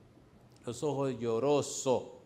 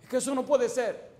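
incredulous outburst; 12 semitones higher, 5 dB louder than usual. Retold or imitated. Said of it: "incrédulo".

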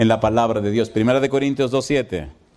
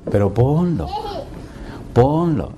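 little inner voice; 4 semitones higher, slower than usual. Retold or imitated. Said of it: "empezó esa vocecita".

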